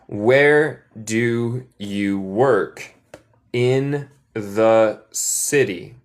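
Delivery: very slow, word by word; slow model phrase. Shown as a demonstration. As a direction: lay out each word slowly for students. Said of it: "repeat model".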